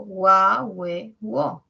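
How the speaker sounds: gua loud, then we, wo; diphthong drill. Shown as a demonstration. Teacher sounding out diphthongs with u, emphatic. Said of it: "tenemos otro diptongo".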